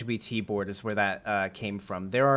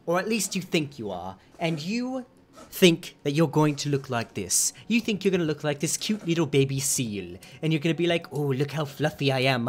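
a lightly put-on thick British accent, raised a bit, mocking; 3 semitones higher than usual. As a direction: imitate a snide British YouTuber ranting, a touch higher. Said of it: "a very thick British accent".

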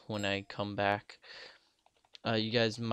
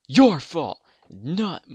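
high, very loud accusing yell; sudden mock-outraged shout; shouted blame; 7 semitones higher, 14 dB louder, much slower than usual. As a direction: burst out yelling the blame. Blaming the player, shouted.

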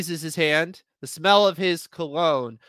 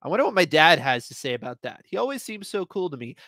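usual speaking voice

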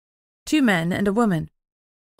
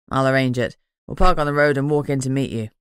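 courteous man, voice pitched lower; lower male voice; 5 semitones lower, 4 dB louder than usual. A narrator's reading. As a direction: speak as a polite younger man, low and even.

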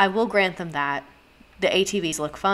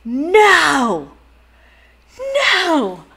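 loud, high, drawn-out "no"; dismayed outcry; 10 semitones higher, 8 dB louder than usual. Real, not acted. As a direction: wail it in dramatic horror. Horrified discovery of Trump flag.

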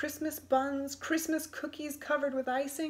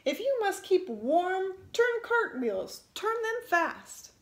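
much higher, loud bossy command; roaring order; 8 semitones higher, 4 dB louder than usual. A narrator's reading. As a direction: bark orders at the shivering boy. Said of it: "roared the little man".

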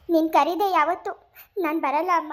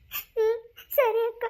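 tearful; 7 semitones higher, much slower than usual. Acted. Bride told to wipe tears.

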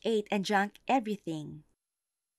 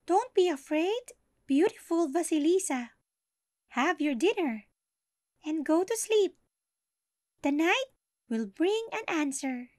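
doll voice; 10 semitones higher, 3 dB louder than usual. Acted. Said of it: "The doll replied".

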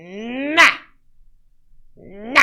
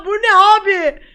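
laughing surprise; 9 semitones higher, 6 dB louder than usual. Real, not acted. Shocked at makeup filter.

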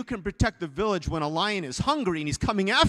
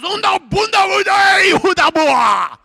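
warrior's yell; 19 dB louder than usual. Acted. Preacher acts the warrior taunting the lion, yelling. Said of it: "he yells more".

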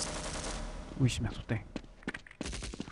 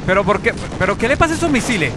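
higher, louder and fast complaint; exasperated shout; 8 semitones higher, 9 dB louder, faster than usual. Real, not acted. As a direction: gamer shouting at his missiles in disbelief. Missiles misfire.

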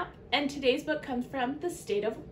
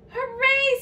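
high, loud storybook hooray; triumphant cheer; 12 semitones higher, 6 dB louder than usual. A narrator's reading. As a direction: cheer like you have finally arrived. Snail celebrates arriving.

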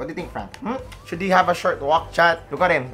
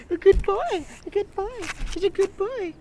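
praising the dog. Real, not acted.

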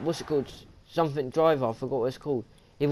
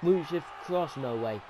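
ordinary talk

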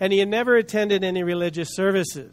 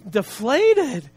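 normal talking voice

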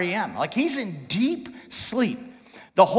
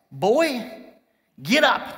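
higher, louder stern command; exasperated dad; 4 semitones higher, 4 dB louder, slower than usual. Acted. Captain rousing sleeping Jonah like a dad, exasperated. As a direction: order a lazy teenage son out of bed. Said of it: "it's like a dad to a s".

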